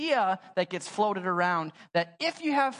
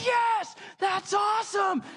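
high, slow, shouted celebration; excited cheer; 13 semitones higher, slower than usual. Retold or imitated. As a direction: yell it like the ball just cleared the fence. Team celebrates home run.

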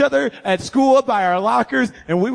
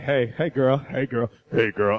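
put-on low, smooth hey girl, sinking lower each time; smooth pickup line; 10 semitones lower, 4 dB quieter than usual. Teen rehearsing his call to Lindsay, low and smooth. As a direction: croon it low like a smooth ladies' man.

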